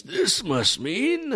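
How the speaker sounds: slow, very high dawning realization; Jarl. Acted. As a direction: stretch it out high, as if working it out. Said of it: "Jarl says".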